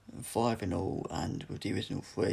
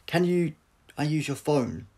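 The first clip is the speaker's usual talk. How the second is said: loud, high, eager question; Doctor eagerly asking the soldiers for a phone; eager request; 7 semitones higher, 9 dB louder than usual. Acted. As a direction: rush to ask for the phone.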